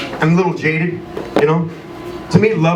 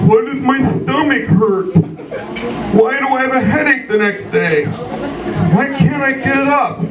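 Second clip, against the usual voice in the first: high, slow whiny groan; mock hangover whine; whiny hungover self; 8 semitones higher, slower than usual. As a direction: whine it like a sorry drunk. Drunk self whining about hangover.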